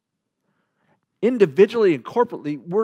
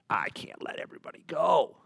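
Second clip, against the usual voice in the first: whispered, softer refusal as Pharaoh; strained whisper; 6 dB quieter than usual. Acted. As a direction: whisper it like a reluctant negotiator. Pharaoh acted out refusing in negotiation.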